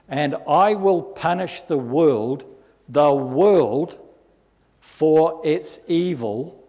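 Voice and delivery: booming scripture proclamation; preaching at full force. Acted. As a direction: hammer each line of judgment home. Reads prophecy from Isaiah.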